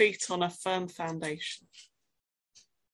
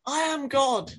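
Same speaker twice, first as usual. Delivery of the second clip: high, loud divine rebuke; God yelling a command; 9 semitones higher, 3 dB louder than usual. Acted. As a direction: shout it with full authority. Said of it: "God says".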